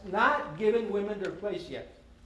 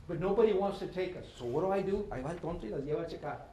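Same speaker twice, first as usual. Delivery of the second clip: normal talking voice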